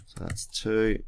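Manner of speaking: usual speaking voice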